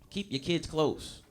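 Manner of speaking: normal talking voice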